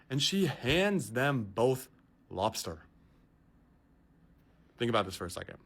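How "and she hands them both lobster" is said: usual speaking voice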